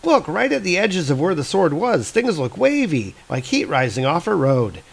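put-on high girl voice, eager and insistent; urgent young girl. Hildi telling her brothers about Loki's cloak, high urgent girl voice. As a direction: explain it fast like a sharp kid who's sure. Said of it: "And Hildi's like".

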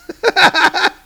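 real high-pitched laugh; high burst of laughter. Real, not acted. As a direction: crack up at the joke. Laughing at own line.

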